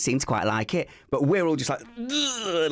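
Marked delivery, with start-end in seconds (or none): 2.0-2.7 high-pitched mock shudder of disgust; disgusted groan. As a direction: recoil as from nails on a chalkboard. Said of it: "like nails on a chalkboard".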